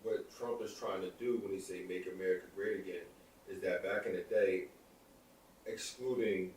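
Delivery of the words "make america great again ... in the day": ordinary talk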